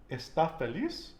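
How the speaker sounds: "está feliz" stressed es-TÁ fe-LIZ, rising high; "está feliz" as a question, final syllables stressed. Shown as a demonstration. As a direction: punch the ends of "está" and "feliz". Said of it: "where I'm putting the emphasis".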